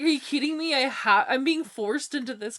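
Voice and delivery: high, loud mock indignation; upset player. Co-host acting players forced into the quest, indignant and incredulous. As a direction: protest like an outraged gamer. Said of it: "They're like".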